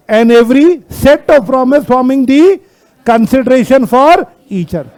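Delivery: drawn-out reading, phrase by phrase; stretched words with pauses between phrases; slow read-out. Shown as a demonstration. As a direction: read the clause very slowly to a class. Reading the law's wording to class.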